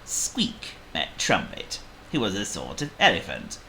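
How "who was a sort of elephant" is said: usual speaking voice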